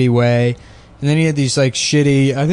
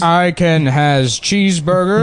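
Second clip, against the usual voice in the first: slow, higher celebrity impression; George Takei; 5 semitones higher, slower than usual. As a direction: draw it out as a hammed-up celebrity. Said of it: "not a particularly good George Takei".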